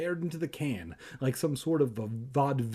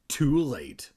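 ordinary talk, nothing marked

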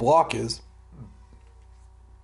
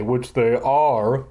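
plain reading voice

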